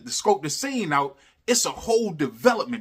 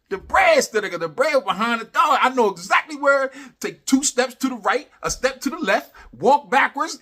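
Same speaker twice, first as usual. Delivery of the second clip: higher and louder, building hype; excited; 8 semitones higher, 5 dB louder than usual. Retold or imitated. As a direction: rattle off the plan, all hyped up. Retelling plan to find buried cash.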